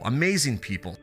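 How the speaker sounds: ordinary delivery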